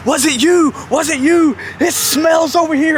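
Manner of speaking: high mock interrogation; Jeff Jarrett. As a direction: imitate a frantic accuser grilling each passenger. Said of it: "He's grabbing folks by the shoulder".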